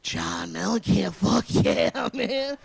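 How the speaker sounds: slow, loud, drawn-out stoner cheer; mock stoner fan whooping with laughs. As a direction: hype it up like a baked rock fan.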